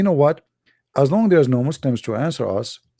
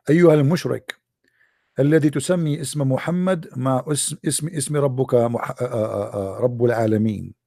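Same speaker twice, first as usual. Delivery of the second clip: rapid Arabic; much faster than usual. Real, not acted. Fast Arabic address.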